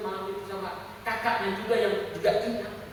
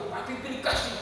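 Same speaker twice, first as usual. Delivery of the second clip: higher-pitched imitation of an upset child; mock protest, pitched up but not shouted; retold child's protest; 6 semitones higher than usual. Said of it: "Kenceng dia". (menirukan protes anak, nada lebih tinggi)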